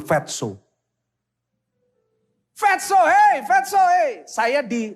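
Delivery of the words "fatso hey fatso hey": loud, high-pitched name-calling; imitated taunting shout. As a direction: holler the nickname like teasing schoolmates. Imitates schoolmates calling his nickname.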